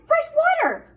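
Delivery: delighted shout. Acted. Armadillo crying out happily about fresh water, high and loud. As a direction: burst out with glee.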